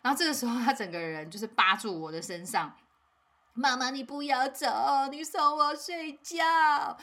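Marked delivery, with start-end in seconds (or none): 3.5-7.0 high, very slow whining plea; clinging child. Retold or imitated. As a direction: stretch it out like a kid hanging on mom. Child clinging, begging mom.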